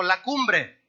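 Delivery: slow call for the church to repeat; call and response. Shown as a demonstration. Preacher prompting the congregation to say it with him, slowly. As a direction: draw out la cumbre for them to echo. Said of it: "di conmigo".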